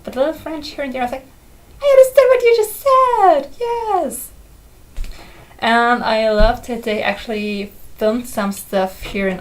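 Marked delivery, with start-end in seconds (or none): 1.8-4.3 much higher and louder, triumphant; excited cheer. Retold or imitated. Cheering at the TV for understanding French, excited squeal. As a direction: squeal it like you just won.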